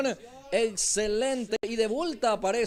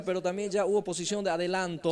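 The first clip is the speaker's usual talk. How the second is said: ordinary talk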